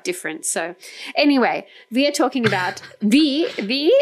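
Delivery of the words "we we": put-on playful German accent on "we", said as "vee"; mock German "vee". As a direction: slip jokingly into a German accent. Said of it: "I'm doing my German accent now".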